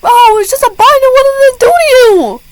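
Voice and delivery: high, loud mocking rant; mock the teacher with a high, outraged voice; sassy outburst. Student sassing the teacher about the binder, high and loud.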